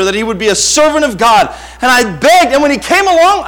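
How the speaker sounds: loud, high, impassioned testimony; ringing pulpit delivery; full-force preaching. Real, not acted. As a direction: preach it out with long stressed words.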